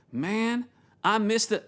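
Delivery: attempted North Carolina accent; Jason's North Carolina accent. Worship pastor reminiscing to a colleague, attempted North Carolina accent. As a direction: put on a rough North Carolina twang. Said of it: "I'm trying to do Jason's accent".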